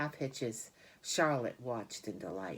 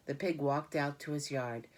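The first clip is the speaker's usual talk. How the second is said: ordinary delivery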